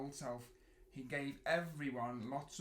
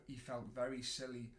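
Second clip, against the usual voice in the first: ordinary delivery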